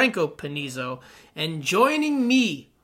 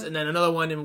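normal talking voice

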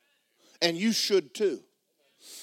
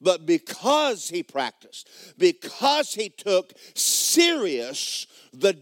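a louder, slow preaching cadence; full preaching; 4 semitones higher, 4 dB louder, slower than usual. Real, not acted. Preacher telling the congregation why Rocky wins, at full force. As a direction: lean into each phrase like a sermon. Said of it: "I'm preaching pretty good".

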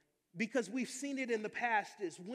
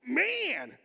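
amazed; 4 semitones higher, 8 dB louder than usual. Retold or imitated. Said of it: "and you're like".

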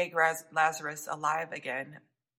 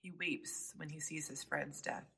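whispered; 13 dB quieter than usual. Acted. Preacher telling the grieving congregation, whispered. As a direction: drop to a whisper on the grief. Preacher hushes on Jesus weeping.

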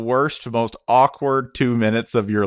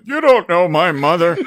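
loud, high mock outburst; hammed-up offended cry; indignant stall man; 9 semitones higher, 7 dB louder than usual. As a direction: protest hotly from the stall. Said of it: "he'd be like".

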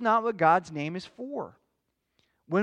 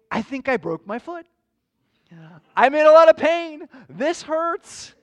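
higher and louder, hammed-up cry of pain; mock pained outcry; 8 semitones higher, 12 dB louder than usual. Preacher acting out dropping something on his foot, mock pained cry. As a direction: act out the hurt of a stubbed foot, big and high.

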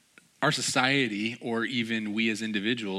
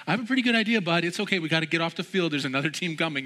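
coaxing dad; 8 semitones higher, faster than usual. Retold or imitated. Father coaxes son off field.

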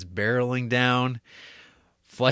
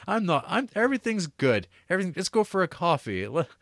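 high, hammed-up breezy cover-up; mock cheerful magistrate; 7 semitones higher than usual. Magistrate waving off the dog trouble, high and breezy. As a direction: laugh it off to a friend, covering nervously.

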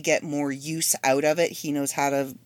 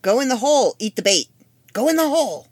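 higher, louder urging go in the hole; urging the ant; 5 semitones higher, 4 dB louder than usual. Retold or imitated. Woman urging an ant into the trap, rising insistence. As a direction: egg the ant on, pushier each time. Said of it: "It's like".

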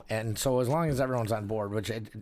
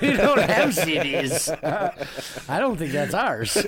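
louder, higher, slowed and broken by real laughter; laughing; 6 semitones higher, 7 dB louder, slower than usual. Real, not acted. Co-host repeating the band's reply, laughing loudly. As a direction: repeat it cracking up.

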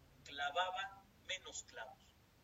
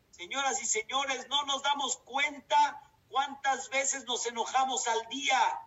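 preaching loudly; 6 semitones higher, 10 dB louder than usual. Real, not acted. Preacher addresses congregation.

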